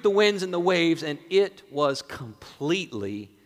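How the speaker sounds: preacher proclaiming the text; dramatic Scripture reading. Acted. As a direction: read the storm story out with weight. Reads the passage on.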